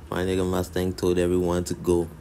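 usual speaking voice

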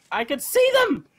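high-pitched squeal of alarm; panicked cry. Real, not acted. Player spotting enemies closing in, panicked cry.

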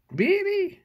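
high, loud, disbelieving question; incredulous surprise. Real, not acted. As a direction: shoot way up in pitch, astonished. Disbelief at finding a spanner included.

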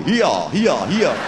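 mock donkey bray on "here". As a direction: bray "here" as hee-ah, rising and falling. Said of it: "trying to do a donkey".

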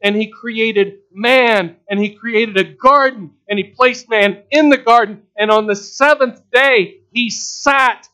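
loud, high, slow pulpit cadence; full-force preaching. Real, not acted. Preacher proclaiming creation to congregation, full-force preaching. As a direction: ring out each act of creation.

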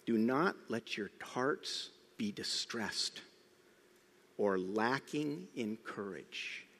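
soft, slow peaceful words; gentle reassurance. Retold or imitated. Preacher voicing Jesus comforting the disciples, soft and slow. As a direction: speak it slowly and quietly, like comfort. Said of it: "He says".

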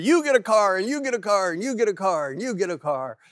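high, loud chanting giveaway announcement, easing down at the end; Oprah Winfrey. Retold or imitated. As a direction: call out prizes one by one, punching each "you". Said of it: "the famous, uh, Oprah Winfrey".

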